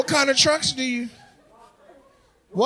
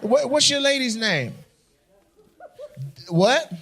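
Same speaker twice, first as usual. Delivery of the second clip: ordinary delivery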